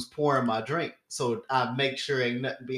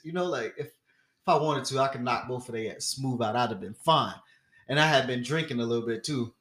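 usual speaking voice

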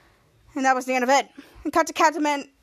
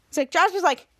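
ordinary delivery

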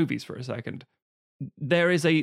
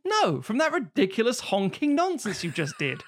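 mock exasperation; 7 semitones higher than usual. Acted scolding in mock argument.

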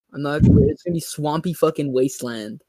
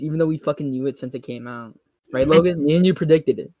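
ordinary talk, nothing marked

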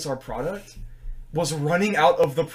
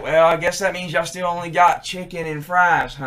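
1 Southern customer; 2 semitones higher, 6 dB louder than usual. Retold or imitated. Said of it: "He'd be like".

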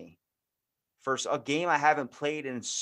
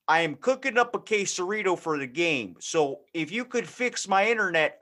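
clueless dad; 3 semitones higher than usual. Acted. Clueless dad calling his internet provider, folksy voice. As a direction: play a cheerful clueless dad. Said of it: "that dad that doesn't actually know".

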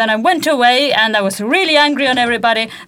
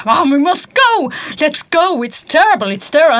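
louder, higher, urging to leave; retold angry plea; 4 semitones higher, 4 dB louder than usual. Daughter urging her father to leave, angrily. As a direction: insist on going, fed up. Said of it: "so angry there".

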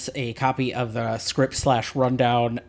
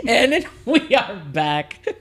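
laughing; 8 semitones higher, 4 dB louder, slower than usual. Real, not acted. Laughing after his own joke.

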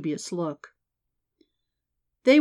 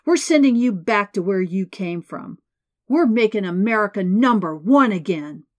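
louder ranting at the crowd; angry yelling; 7 dB louder than usual. A narrator's reading. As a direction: keep ranting, full of hate. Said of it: "he screamed".

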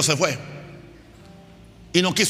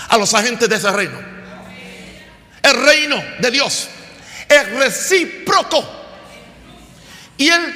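raised-pitch sermon delivery with drawn-out key words; emphatic preaching; 5 semitones higher, slower than usual. Real, not acted. Pastor explains that the kingdom rewards its agents, emphatic preaching. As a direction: proclaim it with weight on the big words.

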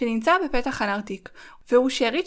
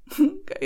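laugh; 7 semitones higher than usual. Real, not acted. Laughs at own accent.

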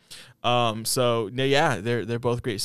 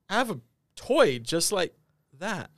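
surprised realization; 7 semitones higher than usual. Retold or imitated. Realization building.

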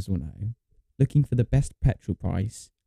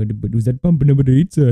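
imitated Indian accent; 5 dB louder than usual. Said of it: "just do the accent".

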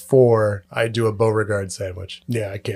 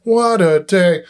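slow, drawn-out, higher and louder mock weariness; exaggerated sigh line; 6 semitones higher, 4 dB louder, slower than usual. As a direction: ham up a tired ending line. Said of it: "you'd have that exaggerated".

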